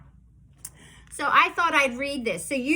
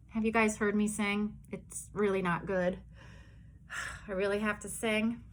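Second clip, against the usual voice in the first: soft, sighing reluctance; mock reluctant; 4 semitones lower, 8 dB quieter than usual. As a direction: pretend dread of singing. Dreading singing.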